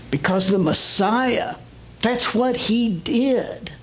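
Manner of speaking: high, slow, ringing sermon delivery; impassioned preaching. Real, not acted. Preacher telling church of the Messiah on the cross, impassioned preaching. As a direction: preach it with drawn-out emphasis.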